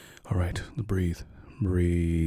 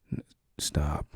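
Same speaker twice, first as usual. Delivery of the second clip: whisper; 3 semitones lower, 4 dB quieter than usual. Acted. Pleading with stubborn partner.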